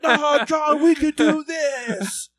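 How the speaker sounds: Ross. Retold or imitated. Voicing the very drunk friend Ross.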